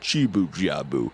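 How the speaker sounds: slow, drawn-out silly character voice; lightly put-on cartoon shaman; character warm-up. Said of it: "I do have to warm up".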